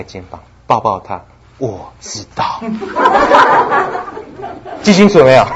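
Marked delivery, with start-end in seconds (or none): 1.6-2.6 soft, slow and quiet; comforting. Shown as a demonstration. Husband comforts his hurting wife, soft and slow. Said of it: "你不要给她答案". 2.6-4.8 a high, airy burst of laughter; breathy laugh. Real, not acted. Preacher laughs at his advice to husbands, high and airy.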